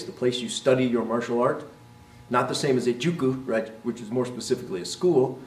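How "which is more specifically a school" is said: normal talking voice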